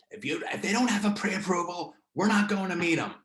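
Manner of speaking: lightly hammed-up Jersey accent; imitated Jersey accent. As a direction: put on a Jersey accent, refusing as an agent would. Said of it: "Jersey accent".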